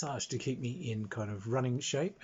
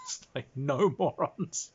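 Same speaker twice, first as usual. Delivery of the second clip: ordinary delivery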